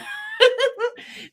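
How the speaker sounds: high burst of laughter. Real, not acted. Laughing at pipe picture.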